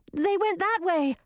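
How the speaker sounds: high, urgent call without much volume; shouted. A narrator's reading. Girl pointing out the chase to companion, high urgent shout. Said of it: "Gracina shouted".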